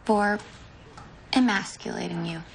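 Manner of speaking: grudging tone. Pretend. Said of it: "say it in a grudging tone".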